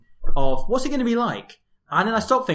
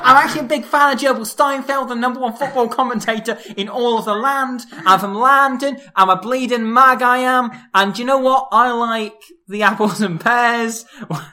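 Guy Ritchie Cockney; 2 semitones higher than usual. Pretend. Host as Guy Ritchie introducing his theme tune, mock Cockney. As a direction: lay on a silly Cockney bloke. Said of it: "because I'm Guy Ritchie and I'm from London".

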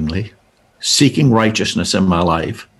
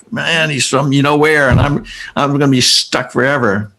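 higher-pitched retold inner whine; mock exasperation; 5 semitones higher than usual. Caller sure he will be stuck forever, mock exasperated. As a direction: complain to yourself, mildly hammed-up.

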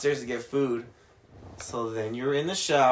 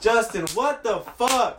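high, drawn-out scolding call; imitated appalled roommate, pitched up; mock exasperation; 7 semitones higher, 3 dB louder, much slower than usual. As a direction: call him out slowly in pretend outrage. Acts out roommates' disgusted discovery.

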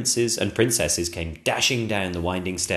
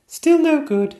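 put-on high childlike princess, apologetic; a small girl sorry to disappoint; little princess voice; 9 dB louder than usual. Princess Sonia telling the king it won't work, high and childish.

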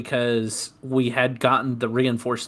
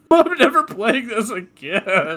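laughing mock sulk; 7 semitones higher than usual. Retold rage quit, laughing.

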